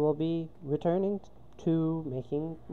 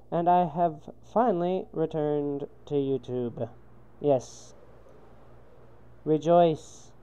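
stiff, loud robotic announcer voice; old speech synthesizer; 3 semitones higher, 5 dB louder, slower than usual. Pretend. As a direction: declaim it like an old computer voice. Grand alien-style comeback greeting.

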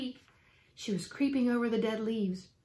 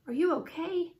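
raised-pitch, gentle check on a pet; mild high-pitched concern; 6 semitones higher than usual. A narrator's reading. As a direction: ask a little animal, voice lifted. Girl checking on pet mouse.

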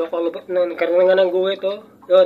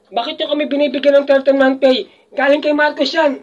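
higher-pitched put-on voice of an ungrateful worker; mock their indignant complaint, pitched up; higher mock voice of a complainer refusing the thirteenth month pay; mock indignant quote; 7 semitones higher than usual. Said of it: "Sabihin nyo".

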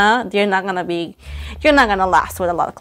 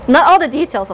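teasing exclamation; 6 semitones higher, 5 dB louder than usual. Real, not acted. Teasing correction.

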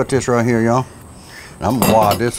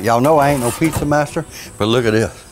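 ordinary talk

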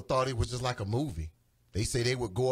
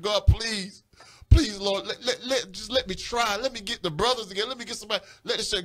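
pleading; 7 semitones higher, 4 dB louder than usual. Retold or imitated. Pleading with God in retelling.

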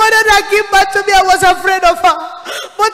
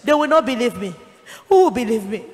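put-on lower, softer pleading girl; poor girl afraid of powerful pastors, softly pleading; frightened plea; 5 semitones lower, 4 dB quieter than usual. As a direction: beg Jesus not to send her back. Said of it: "And then I say to Jesus".

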